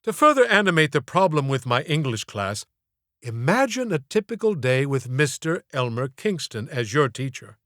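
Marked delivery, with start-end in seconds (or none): none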